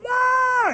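one long loud high wail; mock tantrum howl; imitated child crying. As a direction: wail like a kid throwing a fit. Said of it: "Isaac crying".

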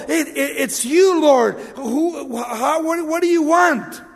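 high, loud, stammering fright; fearful. Acted. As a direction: act a tormented demon stammering before the Lord. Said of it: "tormented and is fearful".

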